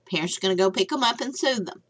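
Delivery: ordinary talk, nothing marked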